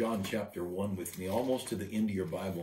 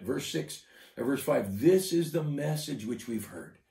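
forceful preaching; 3 semitones higher, 4 dB louder than usual. Acted. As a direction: preach it out with fire. Preacher rises to full force.

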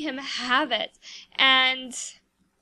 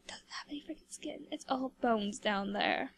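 hushed, breathy gossip aside; whispered aside; 2 semitones lower, 10 dB quieter than usual. Real, not acted. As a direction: whisper the joke as a snarky secret. Snarky aside about Grim Reaper.

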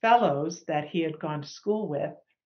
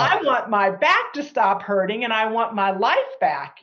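fed-up outburst; 4 semitones higher, 6 dB louder than usual. Retold or imitated. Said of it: "I'm like".

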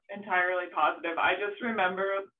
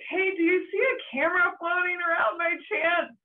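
retold yell; 9 semitones higher than usual. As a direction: imitate calling across the water to someone. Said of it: "And I just yelled".

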